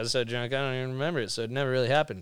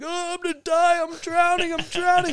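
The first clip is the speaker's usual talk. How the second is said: hammed-up drowning panic, much higher pitch; mock panic. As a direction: squeal it like you're going under. Acting out panic while drowning.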